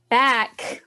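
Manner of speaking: loud, high movie-title flourish; mock-dramatic punchline. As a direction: announce it like a film title, playfully. Film title quoted as a punchline.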